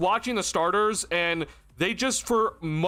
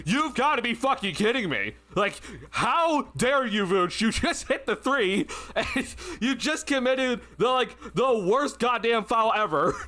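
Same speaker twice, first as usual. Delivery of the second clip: imitating exasperation; 5 semitones higher than usual. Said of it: "I was like".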